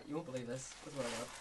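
ordinary talk

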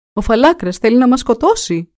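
a frightened prince raising the alarm; alarmed shout. A narrator's reading. Prince wakes, sees drawn sword, shouts alarm. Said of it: "φώναξε".

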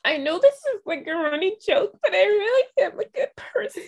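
high, hammed-up weepy voice; mock tearful plea. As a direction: plead as if crying, for laughs. Mocking Alyssa's running-joke plea.

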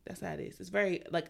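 ordinary talk

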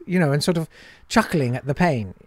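ordinary talk, nothing marked

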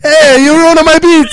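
high, hammy fake Italian accent; terrible Italian accent. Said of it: "a terrible Italian accent".

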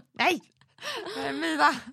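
high, loud mock indignation; mock possessive shout. Mother retelling how she refused Walter a sandwich, high possessive shout. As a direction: yell it like a greedy child guarding food. Mimics guarding her sandwiches from child.

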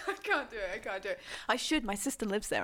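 usual speaking voice

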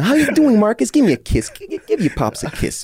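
high, loud, hammed-up fatherly gushing; mock dad greeting. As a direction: play the over-eager dad begging for a kiss. Dad greeting son in story.

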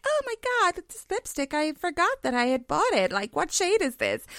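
high, gushing mock delight at the lipstick; mock surprise. Agent finding lipstick in her bag, high and gushing. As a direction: squeal over a forgotten lipstick. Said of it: "you're like".

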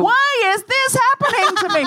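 put-on high, loud whiny American accent; spoiled rich American whine. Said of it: "It's a very offensive American accent".

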